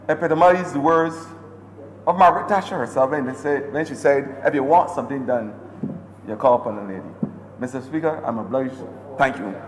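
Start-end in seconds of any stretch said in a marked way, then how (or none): none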